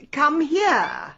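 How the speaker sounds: loud, high order to a pet; exasperated command. Acted. Owner to kitten hiding under the sofa, exasperated command. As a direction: call it out sharply, losing patience. Second, firmer call to the kitten.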